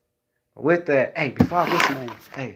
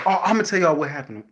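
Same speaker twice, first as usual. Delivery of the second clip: normal talking voice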